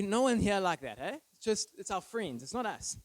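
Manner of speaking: normal talking voice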